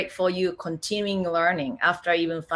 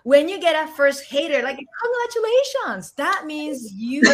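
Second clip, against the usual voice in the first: cheerful congratulations; 6 semitones higher than usual. Retold or imitated. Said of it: "I think I used to say in a class".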